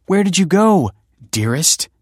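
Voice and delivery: high, loud sugary mock affection; mock-sweet. Kelsey plays the loving wife before guests, loud sugary mock affection.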